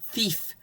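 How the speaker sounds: "thief" said softly with a clear "th" and a final "f"; "thief", soft and distinct at both ends; careful th and f. Shown as a demonstration. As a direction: model "thief" for a child to copy. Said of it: "begins with th and ends with f".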